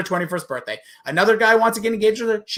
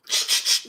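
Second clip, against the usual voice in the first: mock hushing shh; 6 dB quieter than usual.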